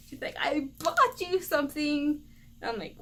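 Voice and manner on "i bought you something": higher-pitched imitation of mom announcing a gift; mock-proud mom with a present; mom's proud announcement. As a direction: lift it up like presenting a surprise. Mom repeats her gift announcement.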